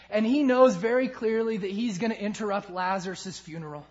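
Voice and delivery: ordinary delivery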